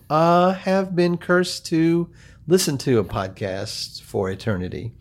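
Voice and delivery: slow, stretched-out show opening; podcast intro announcer. Acted. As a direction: address all listeners in a measured host delivery.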